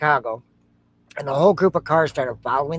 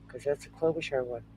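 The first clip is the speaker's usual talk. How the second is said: ordinary talk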